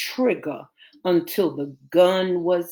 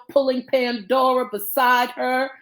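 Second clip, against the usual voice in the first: ordinary delivery, nothing marked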